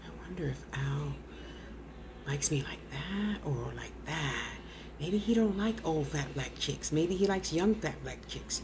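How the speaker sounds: whispered, low, fretting to herself; mock-worry in a hushed whisper; mock insecure whisper. Mocking herself fretting over Al, whispered. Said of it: "I'm not sitting here, "Oh".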